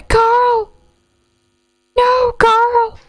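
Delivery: high hammed-up cry for Carl; mock anguished wail. Mourning the robot Carl, hammed-up wailing. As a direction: wail Carl's name in fake grief.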